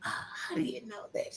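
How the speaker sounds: soft, high surprised whisper; whispered reply. Retold or imitated. Star answering a whispering young fan, whispering.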